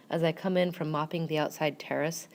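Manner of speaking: ordinary delivery, nothing marked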